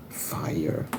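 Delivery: breathy whisper of one word. Shown as a demonstration. Vlogger praising the old Charlie's Angels, in a whisper. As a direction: hush it like a secret verdict. Repeating her verdict on old film.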